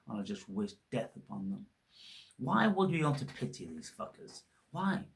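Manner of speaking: ordinary talk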